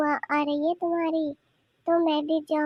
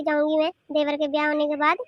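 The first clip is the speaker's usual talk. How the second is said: usual speaking voice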